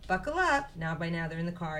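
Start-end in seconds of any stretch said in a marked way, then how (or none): 0.1-0.7 high, loud bossy mother hen; Mama Chicken voice. A narrator's reading. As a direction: call it out like a brisk mom waking her chick. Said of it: "Mama says".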